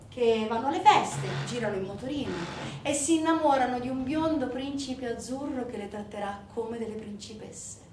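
ordinary delivery, nothing marked

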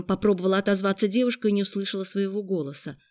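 ordinary delivery

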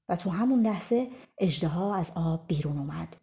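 plain reading voice

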